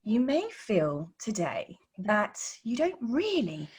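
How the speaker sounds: slow, soft, overly careful presenter voice; mock the stiff on-camera delivery of a new course creator; stilted course-creator tone. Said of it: "I'm just giving the tone".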